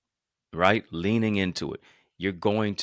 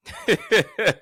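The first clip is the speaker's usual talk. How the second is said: laugh; 13 semitones higher, 6 dB louder than usual. Real, not acted. Laughing at own example.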